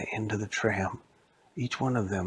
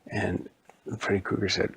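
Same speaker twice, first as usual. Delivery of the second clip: ordinary delivery, nothing marked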